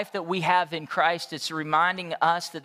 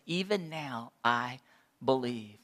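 soft, slow affirmation; 7 semitones lower, 7 dB quieter than usual. Acted. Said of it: "She says".

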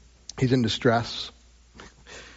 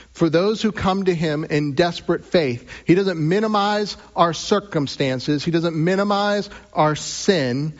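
building sermon climax; 6 semitones higher than usual. Acted. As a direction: lift it to a stirring close. Rising preaching climax.

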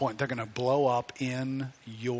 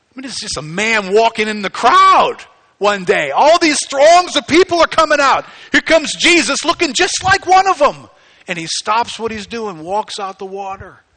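loud, high impassioned preaching; preaching shout; 9 semitones higher, 15 dB louder than usual. Real, not acted. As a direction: preach it at full force to the crowd.

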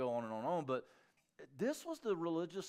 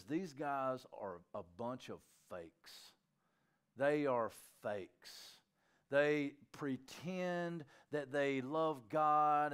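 slow deliberate; much slower than usual. Retold or imitated. Jesus voiced, slow and deliberate.

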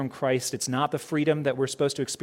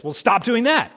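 curt mock counselor; 8 semitones higher, 7 dB louder than usual.

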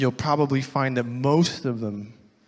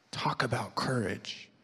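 hushed, breathless whisper of admiration; awed whisper; 8 dB quieter than usual. Real, not acted. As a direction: whisper it like a secret marvel. Preacher marvels at Peter's courage.

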